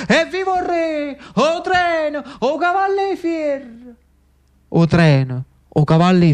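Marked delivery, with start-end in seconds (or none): 0.1-1.2 high, excited crowd cry from afar; cheering crowd. Retold or imitated. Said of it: "che gridava". 1.4-4.0 high, slow, ringing crowd cry of "O treno! O cavalli fier!"; cheering crowd. Retold or imitated. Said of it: "che gridava".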